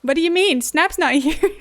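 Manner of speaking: loud, baffled outburst; incredulous. Retold or imitated. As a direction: exclaim it in disbelief, loudly. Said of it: "and I was like".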